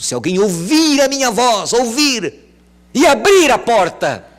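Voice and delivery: high, loud calling voice; Jesus calling. Acted. Jesus calling to whoever is inside, loud. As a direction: call through the door.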